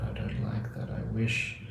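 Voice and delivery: whispered resisting inner voice. Retold or imitated. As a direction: hush "I don't like that" to a whisper. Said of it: "if you resist it".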